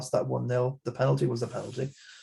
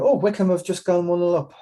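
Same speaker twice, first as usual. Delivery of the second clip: excited goal reaction; 5 semitones higher, 6 dB louder than usual. Real, not acted.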